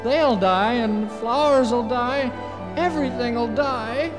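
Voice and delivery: high, loud urgent begging; pleading. Acted. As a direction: plead so the cloud feels sad. Orley pleads with cloud.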